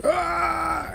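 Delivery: high strained yelp of pain; short mock cry of pain; imitating Scott's pained cry. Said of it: "and he's like".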